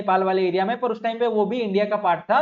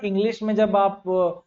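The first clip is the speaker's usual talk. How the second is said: ordinary delivery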